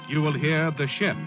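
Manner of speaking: normal talking voice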